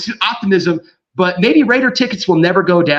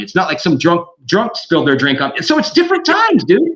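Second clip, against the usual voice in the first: ordinary talk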